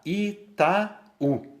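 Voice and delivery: "Itaú" split syllable by syllable, high; syllabes séparées. Shown as a demonstration. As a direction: break "Itaú" into I, ta, ú. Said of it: "uma vogal fica numa sílaba".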